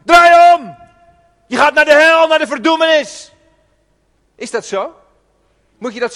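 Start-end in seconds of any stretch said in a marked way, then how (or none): 0.0-0.8 high, loud barked command; mock condemning shout. Preacher imitating a condemning Jesus, loud mock yell. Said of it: "jij leest het alsof Jezus zegt". 1.5-3.3 loud, high threatening yell; mock hellfire shout. Preacher mocking hellfire reading of Jesus, loud threat. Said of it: "jij leest het alsof Jezus zegt".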